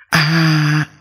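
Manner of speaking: sharp high ghostly shriek of pain; a female ghost shrieks as the talisman burns her; ghost's scream of pain. A narrator's reading. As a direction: let out a burning spirit's cry. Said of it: "Con ma nữ hét lên đau đớn".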